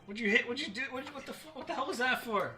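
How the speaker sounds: high, loud cries of pain and outrage; acted hurt, high-pitched indignant outburst; pained yelp. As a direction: yelp as if just struck, then protest. Bard hurt in the scuffle.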